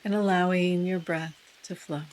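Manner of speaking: unhurried meditation guide with long pauses; guided meditation. Real, not acted. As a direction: ease listeners into stillness, softening as phrases end.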